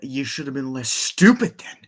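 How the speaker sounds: mock scornful retort. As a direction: act out a movie villain's insult. Acting out Robotnik's insult.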